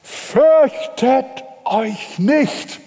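slow, high, ringing proclamation; proclaiming angel. Acted. Angel to the shepherds, slow and high proclaiming. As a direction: draw it out like the angel's call.

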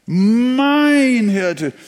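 "Mein" loud and high, long fall; emphasis on "Mein", sung out high; stress on mein. Shown as a demonstration. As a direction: proclaim "Mein" fervently. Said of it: "was steckt denn da wieder drin".

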